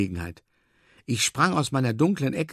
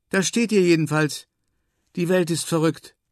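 higher-pitched quoted woman's voice; adding a remark; 6 semitones higher, 3 dB louder than usual. A narrator's reading. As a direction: add it in a high, lively voice. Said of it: "ergänzt Moma". (mit hoher Stimme ergänzt)

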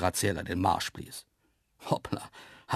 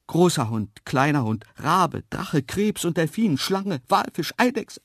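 mounting panic; 7 semitones higher, 6 dB louder than usual. A narrator's reading. Narrator finds ever more star animals, panic mounting. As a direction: list climbing steadily higher and louder.

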